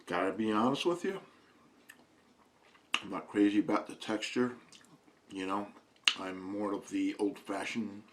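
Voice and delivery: chewing while talking; mouth full. Real, not acted. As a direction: talk through a mouthful of jerky. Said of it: "talking with food in my mouth".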